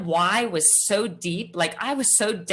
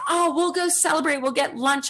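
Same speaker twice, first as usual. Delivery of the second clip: mom's bright, much higher put-on voice; cheery mom voice; 9 semitones higher than usual. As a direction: pitch it way up like an upbeat mom. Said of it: "And she's like".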